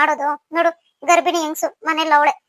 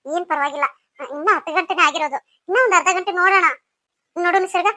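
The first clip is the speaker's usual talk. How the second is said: normal talking voice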